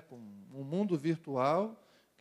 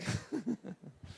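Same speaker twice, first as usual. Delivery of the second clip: helpless chuckle at own joke; real chuckle; 5 semitones higher, 5 dB quieter than usual. Real, not acted. Preacher amused by his own Adam joke, chuckling. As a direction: break off into a real laugh.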